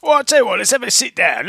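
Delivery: lightly high goblin voice. Acted. Goblin inviting group to rest.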